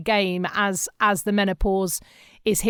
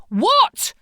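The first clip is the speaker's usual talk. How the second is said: much higher, louder exasperated rising What?; husband baffled and annoyed, sharply rising What?; frustrated what; 12 semitones higher, 5 dB louder than usual. Retold or imitated. Husband cut off mid-request, exasperated high What?. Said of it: "he'd get really frustrated with me".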